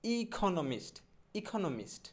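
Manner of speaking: ইকোনমিস্ট with a puffed, aspirated kh for the k; corrected pronunciation. Shown as a demonstration. Teacher correcting economist, ইকোনমিস্ট with aspirated "kho". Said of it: "আমার সাথে সাথে বলবেন".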